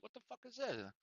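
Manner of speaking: very soft, high, baffled aside; barely voiced question to a coworker; stunned, under-the-breath disbelief; hushed bewilderment. Whispered exchange of looks with coworker.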